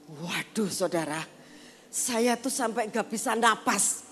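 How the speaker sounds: normal talking voice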